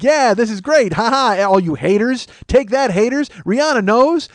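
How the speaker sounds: high, crowing boast; mock gloating. [name] taunting all the haters, mock triumphant crowing. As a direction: imitate a triumphant dim boyfriend. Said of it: "he's just like".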